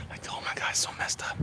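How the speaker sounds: real hushed disbelief; shocked whisper. Real, not acted. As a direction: whisper it, stunned. Shocked reaction to the trailer.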